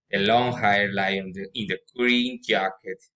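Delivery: ordinary talk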